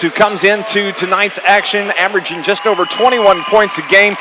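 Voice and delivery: ordinary delivery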